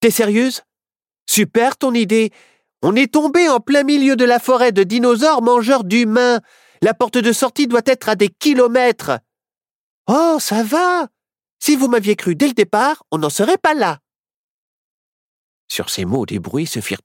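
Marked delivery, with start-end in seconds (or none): 0.0-9.2 high-pitched sarcastic outburst; fed-up companion; exasperated scolding. Acted. Quand Nao la coupa net. 10.1-14.0 high, huffy comeback; huffy little witch; defensive retort. Acted. Witch snaps back at the scolding.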